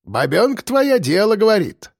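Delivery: wry, winking remark; teasing grandad. Acted. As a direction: approve slyly like an old-timer. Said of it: "подмигнул он ворону".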